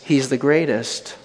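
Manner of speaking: usual speaking voice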